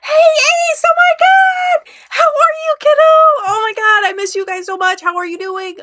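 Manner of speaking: real high, loud squeal of joy; excited freak-out. Real, not acted. Former teacher freaking out on seeing one of their kids, overjoyed shouting. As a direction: shriek a greeting to someone dearly missed. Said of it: "just saw me freak out".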